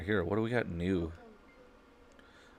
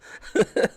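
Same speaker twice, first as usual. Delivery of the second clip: burst of laughter; 6 dB louder than usual. Real, not acted. Laughing at game outcome.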